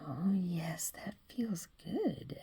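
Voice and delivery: slow breathless whisper; whispering pleasure to the one caressing him; pleased whisper. Acted. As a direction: whisper it like it feels good. Voicing characters in a drawing.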